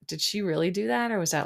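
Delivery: ordinary talk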